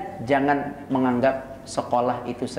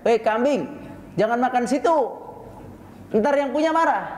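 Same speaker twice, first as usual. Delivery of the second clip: mock yell at goat; 9 semitones higher than usual. Shepherd yelling at his goat, a mock yell. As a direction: shout it like a scolding herder. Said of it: "kalau antum teriak".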